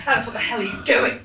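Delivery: ordinary talk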